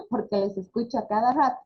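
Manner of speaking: ordinary talk, nothing marked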